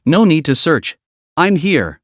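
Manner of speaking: ordinary delivery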